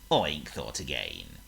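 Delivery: usual speaking voice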